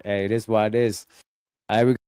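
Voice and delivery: normal talking voice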